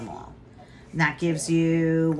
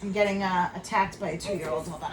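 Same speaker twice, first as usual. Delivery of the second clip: ordinary delivery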